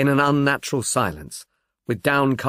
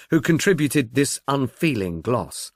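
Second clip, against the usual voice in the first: usual speaking voice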